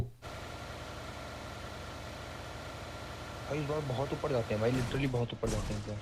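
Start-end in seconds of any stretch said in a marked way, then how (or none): 0.0-3.6 whispered call to a teammate. Real, not acted. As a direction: whisper it so the enemy won't hear. (फुसफुसाकर)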